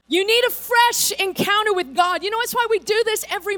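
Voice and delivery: loud, high revival preaching; full-force preaching. Acted. Pastor urging the congregation at full force, loud and high. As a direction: preach it to the back row.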